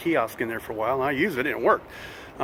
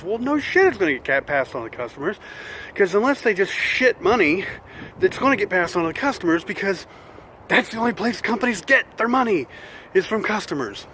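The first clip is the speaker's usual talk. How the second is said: exasperated rant; 6 semitones higher than usual. Real, not acted. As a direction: vent like a chronic complainer. Ranting about prices passed on.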